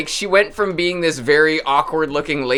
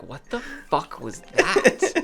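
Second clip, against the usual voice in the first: baffled disbelief; 5 semitones higher, slower than usual. Retold or imitated. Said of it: "you're just like".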